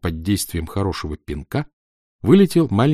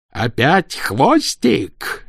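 slow, loud barked outburst; barked roar; 6 semitones higher, 4 dB louder, much slower than usual. A narrator's reading. Stage manager bellowing at the makeup boy, a slow loud bark. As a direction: bellow it so the hall hears. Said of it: "рявкнул Помреж так громко".

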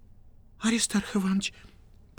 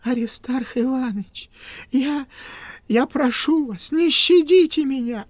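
pleading; 6 semitones higher than usual. Acted. Kuvaldin pleading.

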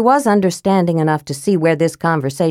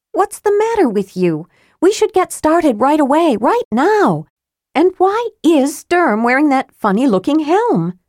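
put-on high, urgent young woman; impatient young woman pushing; Tika's higher voice, impatient; 10 semitones higher than usual. Tika's voice in the story.

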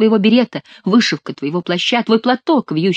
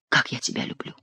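soft low whisper of love; hushed, intimate declaration; 6 semitones lower, 9 dB quieter than usual. A narrator's reading. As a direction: breathe it out tenderly, barely voiced. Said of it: "прошептал Бюсси".

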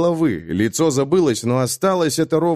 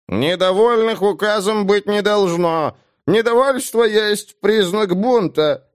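high, slow nasal official; nasal drone; 9 semitones higher, slower than usual. Acted. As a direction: drone it through the nose. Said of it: "прогундосил".

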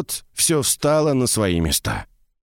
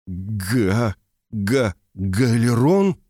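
slow, broken stutter on the name; stammering; much slower than usual. A narrator's reading. Former apprentice recognises his old master, stammering. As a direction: force the name out in halting pieces. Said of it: "заикаясь, выдавил из себя".

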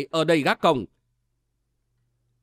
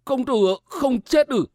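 higher, lightly voiced old man; astonished old servant; 6 semitones higher than usual. A narrator's reading. Old servant finds his master alive, mildly astonished. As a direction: raise the pitch in mild disbelief. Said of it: "Nhị Hồ Tử hỏi".

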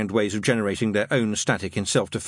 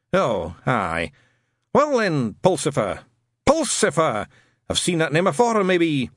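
put-on a touch deflated old Scot; vaguely disappointed; 6 semitones higher, slower than usual. Shadwell disappointed by Newton, mildly deflated. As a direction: let the air out a bit. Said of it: "sounded vaguely disappointed".

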